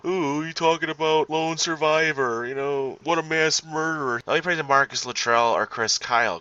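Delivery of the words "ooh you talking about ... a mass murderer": high, whiny mock of the commenter; mocking troll voice. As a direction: sneer it like a prissy troll. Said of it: "Fuck you".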